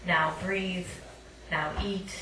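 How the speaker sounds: normal talking voice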